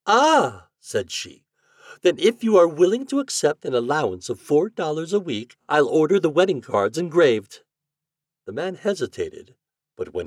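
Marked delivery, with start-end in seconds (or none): 0.1-0.7 very high, loud exclamation; raised feminine storybook reaction; intrigued lady. A narrator's reading. Lady reacts to prince's claim. 2.0-7.6 high, loud lady's voice; businesslike storybook bride; brisk lady bargaining. A narrator's reading.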